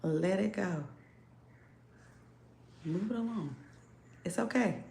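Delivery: soft, hushed coaxing to let go; quiet reassuring murmur; soothing coax. Retold or imitated. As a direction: gently talk someone into letting go. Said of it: "And it's like".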